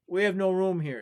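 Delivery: a fussy innkeeper refusing a guest. Acted. Innkeeper turns traveler away.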